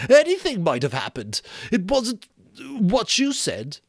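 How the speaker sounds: put-on high, loud, stammering boy; frantic scared Piggy. Piggy frantically justifying the dark night. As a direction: rush it out in panic.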